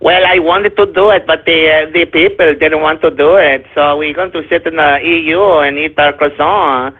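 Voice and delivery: low, droning mock-French accent; mock French bureaucrat. Voicing Le Pen backing down to the people, mock French accent. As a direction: put on a lazy French accent, pitched low. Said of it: "your typical quintessential bureaucratic woman move".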